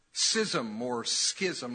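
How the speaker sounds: schism said first as sizzum then as skizzum; two pronunciations. Shown as a demonstration. Preacher asking the church, two ways of saying the word. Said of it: "Is it schism or schism".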